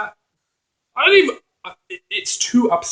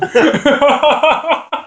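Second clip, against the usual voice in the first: high laugh; 11 semitones higher, 5 dB louder than usual. Real, not acted. Laughing at joke.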